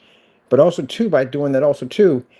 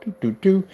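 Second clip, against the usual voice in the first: sing-song doo doo doo, higher; laser sound effect; 7 semitones higher than usual. Retold or imitated. Showing viewers the laser trace with a sung beep. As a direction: hum out the pulse of a laser.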